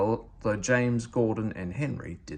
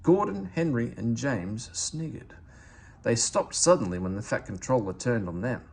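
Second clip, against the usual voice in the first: ordinary delivery, nothing marked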